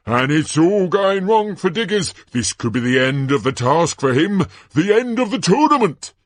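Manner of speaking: high, loud, climbing alarm; Ludo Bagman, dramatic commentator. Acted. Announcer lamenting the champion's setback, overexcited and dramatic. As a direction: make a catastrophe of it for the crowd.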